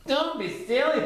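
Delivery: high, snippy rabbit voice; snorting Rabbit. A narrator's reading. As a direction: scoff at Pooh like a fussy rabbit.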